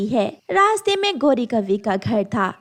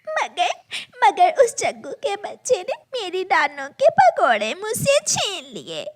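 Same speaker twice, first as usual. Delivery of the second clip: crying; 7 semitones higher than usual. Acted. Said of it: "गुड़िया रोने लग जाती है।".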